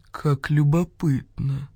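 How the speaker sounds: slow, drawn-out musing voice; a slow, knowing vulture savouring each word; slow, mysterious old vulture voice. A narrator's reading. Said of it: "загадочно кивнул Гриф-стервятник".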